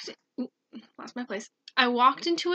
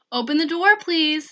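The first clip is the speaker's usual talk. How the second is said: loud, high begging plea; pleading voice; 5 semitones higher, 8 dB louder than usual. Retold or imitated. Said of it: "said a pleading voice".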